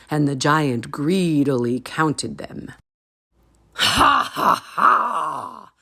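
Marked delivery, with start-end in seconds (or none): 3.7-5.7 giant's roaring laugh. A narrator's reading. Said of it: "he roared".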